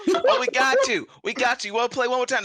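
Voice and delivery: high, loud eager reply; eager elf singer. Acted. Elf singer agrees to play again for a fan, high and loud. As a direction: jump at the chance to play again. Singer answers fan's request.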